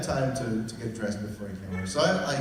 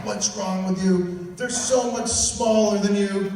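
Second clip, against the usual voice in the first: higher, louder and slow mocking voice; mocking taunt; 8 semitones higher, 7 dB louder, slower than usual. Host imitating people who mock his spider fear, teasing. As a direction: mimic people teasing him. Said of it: "a lot of people make fun of me".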